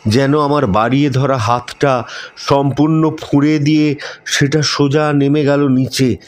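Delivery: usual speaking voice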